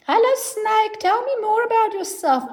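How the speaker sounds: put-on high, lilting child-directed voice; therapist addressing a child. Therapist talks to the child's house puppet, high and lilting. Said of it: "give the snake a voice".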